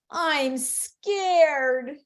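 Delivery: lightly hammed-up fear; pretend fright. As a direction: play scared for a small child. Mom's scared comment repeated.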